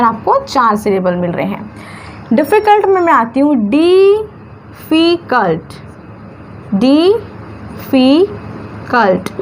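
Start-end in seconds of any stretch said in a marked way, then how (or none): 3.7-5.6 "difficult" very slowly as डि, फ़ि, cult; syllable by syllable. Shown as a demonstration. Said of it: "तीन syllable दिख रहे हैं". 6.7-9.3 "difficult" extremely slowly, long gaps between its three syllables. Shown as a demonstration. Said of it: "तीन syllable दिख रहे हैं".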